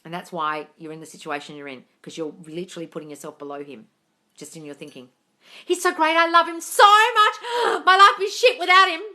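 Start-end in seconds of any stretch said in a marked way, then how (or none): 5.7-9.0 mock lovesick gushing. Mocking a follower who idolises him, swooning gush. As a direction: overplay a girl lost without him.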